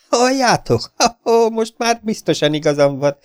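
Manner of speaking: excited triumphant whoop. Acted. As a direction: crow over being right at last. Triumphant discovery.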